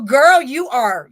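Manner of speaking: a slow, hammed-up sassy compliment; sassy drawn-out. Shown as a demonstration. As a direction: stretch "Girl" out with playful sass. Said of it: "It's so disrespectful".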